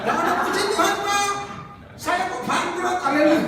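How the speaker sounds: high, loud hammed-up joy; mock jubilation. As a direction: announce bad news as if rejoicing. Contoh konyol kabar buruk dengan sukacita. (pura-pura bersorak gembira)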